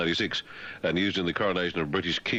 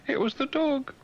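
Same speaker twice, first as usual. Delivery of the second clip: high squeaky reply. Pretend. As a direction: squeak like a guilty royal.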